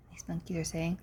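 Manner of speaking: plain reading voice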